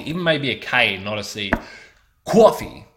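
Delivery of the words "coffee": "Coffee" as "kwawfee", much higher and louder; New York coffee. Retold or imitated. Mocking the American accent, "Coffee" pitched high and loud as "kwawfee". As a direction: belt "Coffee" as "kwaw-fee". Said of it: "the way they say coffee".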